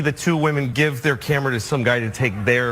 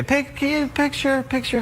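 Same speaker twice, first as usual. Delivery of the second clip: imitated request, much higher voice; high-pitched mimic of eager strangers; mimicking photo askers; 10 semitones higher than usual. Two women asking a comedian for a picture, high mimicry.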